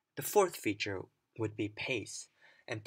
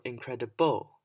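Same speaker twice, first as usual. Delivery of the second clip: "incredible" with BLE punched loud; stress on last syllable; 8 dB louder than usual. Shown as a demonstration. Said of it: "I stressed the different parts".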